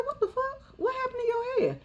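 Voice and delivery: high, startled what-the-fuck; mock shocked squeal; shocked outburst. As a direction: react in high disbelief. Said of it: "I said".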